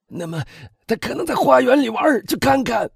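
high voice rising to a shout; desperate father. A narrator's reading. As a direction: urge the servants to go and look. Father desperately sends servants searching. (焦急地高声催促)

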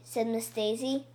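plain reading voice

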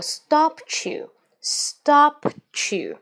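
"stop you" slowly run together as one linked phrase. Shown as a demonstration. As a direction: link STOP straight into YOU, drawn out. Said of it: "细心的朋友听到我这里有个stop you". (stop you 慢速连读)

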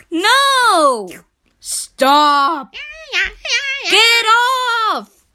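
loud, high panicked yelling; shouting at the dog. Acted. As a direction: yell to make him stop. Chief yells at dog.

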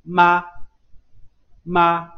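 妈 said as ma, lips pressed shut then opened into a; crisp, emphatic 妈 with closed lips popping open to a; model syllable ma. Shown as a demonstration. Teacher modelling 妈 for students, crisp and emphatic. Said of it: "刚开始双唇紧闭".

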